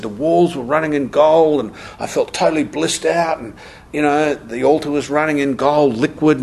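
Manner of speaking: a hint of a young woman swooning over her vision; dreamy girl. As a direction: gush like a girl lost in bliss. Girl's blissful experience retold.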